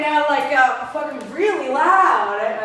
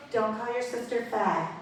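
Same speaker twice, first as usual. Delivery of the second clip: much softer, understated scolding; deadpan mom; 9 dB quieter than usual. Retold or imitated. Mom correcting her son in the kitchen, quiet deadpan. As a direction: drop the volume and deliver it dryly. Mom's deadpan reply as punch line.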